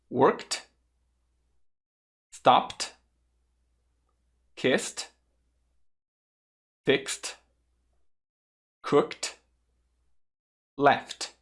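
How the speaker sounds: "Worked", "Stopped", "Kissed" and the rest spaced out, each ending in a crisp T; exaggerated final T. Shown as a demonstration. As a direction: model each word separately for repetition, overdoing the T. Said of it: "A pronúncia foi um pouco exagerada". (cada palavra, de "Worked" a "Left", com T final exagerado)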